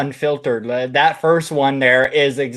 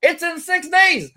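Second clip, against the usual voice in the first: exasperated shout; 14 semitones higher than usual. Real, not acted. Telling listeners about the airplane worry, incredulous shout. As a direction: throw it out in disbelief.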